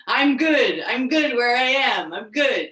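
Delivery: mock triumphant self-assurance; loud affirmation. Shown as a demonstration. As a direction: declare it firmly and loudly. Affirming loudly in a demo.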